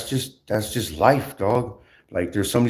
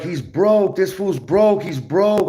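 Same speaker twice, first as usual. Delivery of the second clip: mocking gossipers; 8 semitones higher, much slower than usual. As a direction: play the shocked gossip, voice climbing. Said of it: "they're like".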